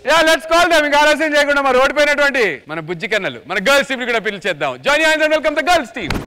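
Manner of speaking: normal talking voice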